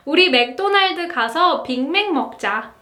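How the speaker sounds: casual native speed; quick everyday Korean sentence; fast natural Korean. Shown as a demonstration. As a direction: rattle off 우리 맥도날드 가서 빅맥 먹자 quickly. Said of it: "So for example, you can say".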